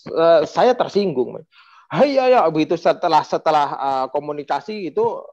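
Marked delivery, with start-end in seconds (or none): 1.9-2.4 a sharp raised "Hei, ayo"; angry retort. Retold or imitated. Old party figure scolding the speaker, sharp and raised. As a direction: snap it out, higher and louder. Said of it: "ngatain saya kayak gini".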